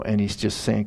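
usual speaking voice

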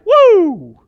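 sudden high, loud whoop of joy; a high ecstatic woo; joyful whoop. Real, not acted. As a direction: burst out with delight. Rapturous preacher exclaiming joy.

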